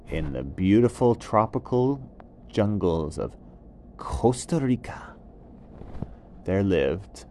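usual speaking voice

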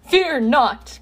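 booming hero's reassurance; All Might. A narrator's reading. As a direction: proclaim it in a tremendous voice. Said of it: "come All Might's tremendous voice".

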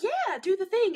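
quoting her. Retold or imitated. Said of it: "she was like".

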